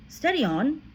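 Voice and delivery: a mild, surprised objection; schoolboy protests mildly; a hint of a puzzled boy. A narrator's reading.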